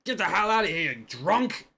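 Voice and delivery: loud angry shout from the tavern; angry yell. Acted. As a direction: yell him out the door. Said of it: "someone yell".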